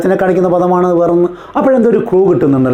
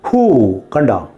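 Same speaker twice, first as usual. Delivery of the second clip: normal talking voice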